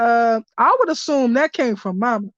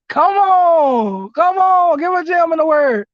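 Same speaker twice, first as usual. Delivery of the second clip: higher and louder, cheering the message on; hyped-up shouting; 5 semitones higher, 6 dB louder than usual. Real, not acted. Reader cheers on the card's words to viewers, in excited shouts. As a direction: cheer like at church.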